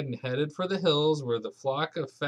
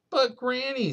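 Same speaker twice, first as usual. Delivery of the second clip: put-on high, small child's plea; a little boy protesting to granny; small boy pleading; 10 semitones higher, 4 dB louder than usual. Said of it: "said Patrick".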